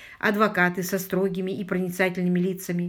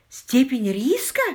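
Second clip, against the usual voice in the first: sharp startled cry, higher, louder, slow; exclaiming; 5 semitones higher, 5 dB louder, slower than usual. Acted. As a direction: blurt it out in disbelief. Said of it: "воскликнула Мэри".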